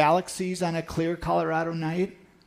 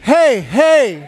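raised voice, calling out love; loud affectionate call; 5 semitones higher, 10 dB louder than usual. Shown as a demonstration. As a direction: call it out so they really hear it. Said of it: "and say".

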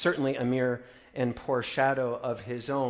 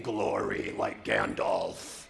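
much lower, slow mock-solemn Gandalf; 5 dB quieter, slower than usual. Preacher comparing the parent to Gandalf, mock-solemn low voice. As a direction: drop into a lightly hammed wizard rumble.

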